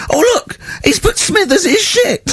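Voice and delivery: very high, shrieking mock outrage; hysterical teacher. Teacher reading out a rude drawing, shrieking outrage. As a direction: shriek it in disbelief. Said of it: "He went".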